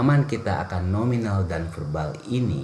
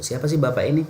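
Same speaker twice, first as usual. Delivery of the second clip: example question; 8 semitones higher, much faster than usual. Shown as a demonstration. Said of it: "saya ingin mendescribe Bapak ini".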